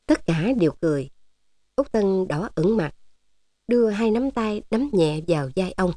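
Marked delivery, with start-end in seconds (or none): none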